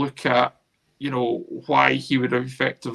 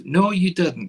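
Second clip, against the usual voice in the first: scoffing retort; 5 semitones higher, 4 dB louder than usual. Real, not acted. Rebutting the retold boast.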